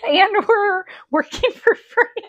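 high, slow, laughing through the words. Real, not acted. Cohost joking about unpaid podcasting, laughing. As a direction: squeeze it out through real laughter.

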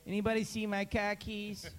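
Boston accent, car keys as "cah keys". Shown as a demonstration. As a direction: drop the r like Boston. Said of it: "after that impression".

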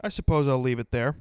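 ordinary talk

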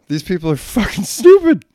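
high, loud disgusted outburst; loud, high exasperated scorn; angry rant. Real, not acted. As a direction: spit out the contempt. Ranting at careless parents.

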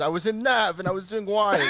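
high, loud mock imitation of a friend; imitating his friend. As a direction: parrot his smug voice. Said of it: "the same voice he says".

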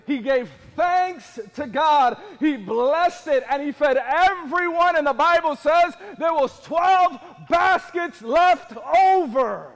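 slow, loud, building to a shout; forceful preaching. Real, not acted. Preacher telling of the loaves miracle, building forceful preaching. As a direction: stretch each phrase towards a shouted climax.

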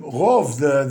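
normal talking voice

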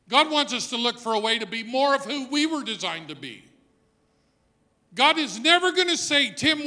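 loud, rising preacher's cry; full-force preaching. Acted. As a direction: preach it out to the back pew. Preacher drives home the point.

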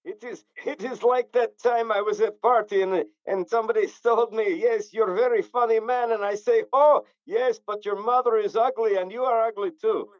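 mock Eastern European storyteller, broken grammar; Eastern European accent. Trading insults told at party, in mock Eastern European accent. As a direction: tell it in a put-on Slavic accent. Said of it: "I do a mean Eastern European accent".

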